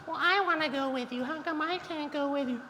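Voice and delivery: high pleading falsetto impression; mimicking emotional ex. Mocking his emotional girlfriend on FaceTime, high whiny falsetto. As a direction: whine it up high like a clingy girlfriend. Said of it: "she was so emotional".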